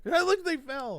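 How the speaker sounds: mock an excited video viewer; mock excitement. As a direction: blurt it out, loud and high. Mocking internet video reaction.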